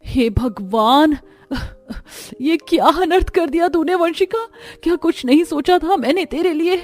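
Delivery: high, wailing lament at her daughter; anguished mother. Acted. Mother wailing at her eloping daughter, high and anguished. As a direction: cry out in despair and begging. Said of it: "फूट फूट कर रोती रह जाती है".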